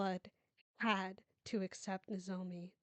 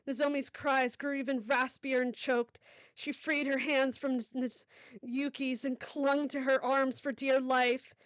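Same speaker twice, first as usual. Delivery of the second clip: louder, fast, tense storytelling; urgent narration; 3 semitones higher, 6 dB louder than usual. A narrator's reading. Narrator building panic in the emergency scene. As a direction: push the narration forward with dread.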